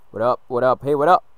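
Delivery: taunting call. Real, not acted. Taunting the opponent, building.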